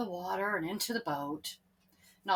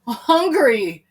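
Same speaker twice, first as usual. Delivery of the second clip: loud hungry whine; hungry moan; 4 semitones higher, 12 dB louder than usual. Acted. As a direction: whine it with hunger. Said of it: "said James".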